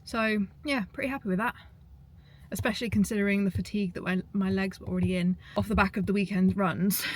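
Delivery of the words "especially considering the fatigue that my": normal talking voice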